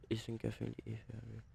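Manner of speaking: soft, French-accented English read-through; reading under breath. Real, not acted. Gamer rereads the instruction to himself, under breath. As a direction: read the on-screen words softly to yourself.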